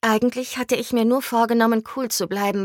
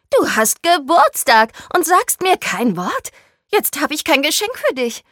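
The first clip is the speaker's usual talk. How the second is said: high-pitched, loud, indignant outcry; shrieking; 7 semitones higher, 6 dB louder than usual. A narrator's reading. Friend has no present ready, shrieking. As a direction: cry it out high, scandalized at your friend. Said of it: "schrie sie".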